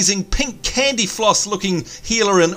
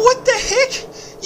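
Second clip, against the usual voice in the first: much higher, slow, laughing cry; startled laughing; much slower than usual. Real, not acted. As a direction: shriek it in amused shock. Startled by sudden prop.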